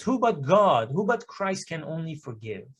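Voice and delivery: usual speaking voice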